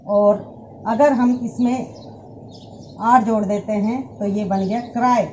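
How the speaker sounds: usual speaking voice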